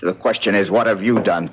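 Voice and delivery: a grim low demand for answers; low accusing demand. Acted. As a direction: drop low and accuse him coldly. Confronting the sculptor.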